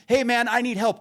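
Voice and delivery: high-pitched mock yell; re-enacted call for help through closed windows; screaming for help. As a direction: cry out high, as if trapped. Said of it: "just screaming at this fucking guy".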